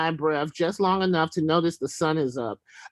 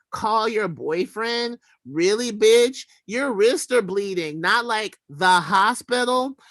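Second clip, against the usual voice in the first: higher, slow, disbelieving attitude; incredulous sass; 5 semitones higher, slower than usual. Retold or imitated. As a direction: drawl it out like you can't believe him.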